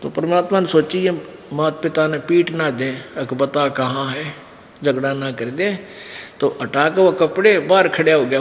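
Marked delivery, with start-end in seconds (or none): none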